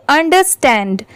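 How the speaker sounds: "Understand" run together as one word; joined up quickly. Shown as a demonstration. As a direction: blend all three parts of "understand" at speed. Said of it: "साथ में मिला देंगे और speed में पढ़ेंगे".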